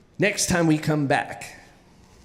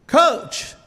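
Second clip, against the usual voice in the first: higher, insistent call; 7 semitones higher than usual. Retold or imitated. Tired kid calling the coach who ignores him, insistent call. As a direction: call out for attention, acted.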